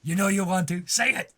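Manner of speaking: urgent, loud goading; insistent egging on. Pretend. Louder egging on a confession.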